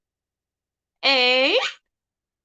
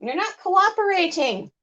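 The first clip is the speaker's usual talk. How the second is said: high pet-talk voice; 7 semitones higher, faster than usual. Real, not acted. Teasing uncooperative parrot.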